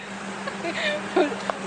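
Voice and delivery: high squealing laugh; burst of laughter. Real, not acted. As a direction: laugh in real surprise. Reacting to X rated word.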